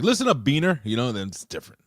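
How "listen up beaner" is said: 1 mock taunt. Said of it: "she's like".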